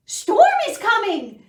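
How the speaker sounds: all the animals crying out at once; chorus of alarm. Acted. Animals telling the sleepy cat, loud high alarm. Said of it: "the animals told her all at once".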